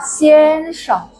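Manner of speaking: "xian sheng" with long high first syllable, short light "sheng"; light neutral tone. Shown as a demonstration. Teacher showing students the short fifth tone, neutral-tone demo. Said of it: "bacanya pendek dan ringan".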